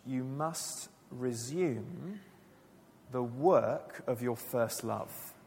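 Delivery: very slow and quiet, each word laid down; slow solemn quote. Shown as a demonstration. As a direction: read the words of Jesus slowly, with weight. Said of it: "Jesus says to his church".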